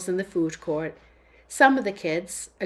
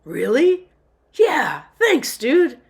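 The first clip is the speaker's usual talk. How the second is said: lit up; 6 semitones higher, 9 dB louder, slower than usual. Acted. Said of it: "Jeremiah lit up".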